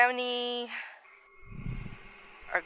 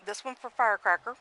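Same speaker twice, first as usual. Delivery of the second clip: ordinary talk, nothing marked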